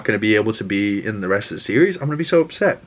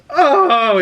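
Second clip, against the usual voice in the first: loud, high-pitched drawn-out oh; excited oh; 5 dB louder than usual. Real, not acted. Fan reacting to Gambit's last line, loud and thrilled. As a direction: cry out at the big moment.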